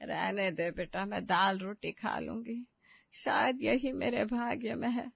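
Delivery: guilt-trip the son with quiet sadness; mother-in-law playing the martyr, soft and slow; self-pitying sigh.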